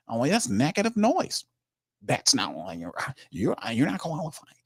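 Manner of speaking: mocking Mickey Loomis. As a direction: lightly sneer it like a smug executive brushing off critics. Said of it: "Mickey Loomis sit up there and said".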